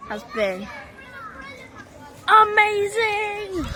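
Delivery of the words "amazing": slow, high, loud amazing; drawn-out excited. Real, not acted. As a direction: stretch it out with delight. Drawn-out final verdict.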